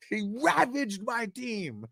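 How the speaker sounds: high, slow whiny voice; mock whine. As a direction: drag it out like a sore loser. Voicing the whining player.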